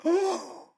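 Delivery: high re-enacted gasp of surprise; astonished gasp. Comic telling of recognizing a long-lost friend, astonished gasp. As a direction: gasp "oh" as if stunned. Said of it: "And I went".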